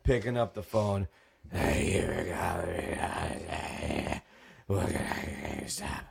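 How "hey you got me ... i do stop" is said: barely-there hoarse croak, lower and softer; croaky sick voice. Retold or imitated. Manager answering the store phone with bronchitis, croaking. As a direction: choke out the greeting with no voice left. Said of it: "I'm barely able to talk".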